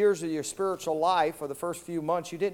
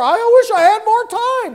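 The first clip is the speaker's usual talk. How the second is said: high, loud desperate cry; pleading shout at the grave; shouting regret; 13 semitones higher, 11 dB louder than usual. Pretend. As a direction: holler it in anguish. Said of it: "you could shout and holler".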